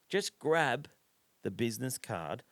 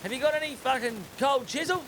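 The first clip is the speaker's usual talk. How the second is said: much higher, hammed-up pleading; eager mock request; 13 semitones higher, 4 dB louder than usual. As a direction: beg the karaoke dude for the song. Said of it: "And I just said".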